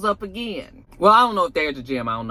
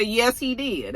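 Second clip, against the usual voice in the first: ordinary talk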